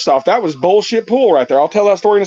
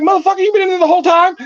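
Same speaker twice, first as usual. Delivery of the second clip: mock outraged yell; 13 semitones higher, faster than usual. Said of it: "I'm like".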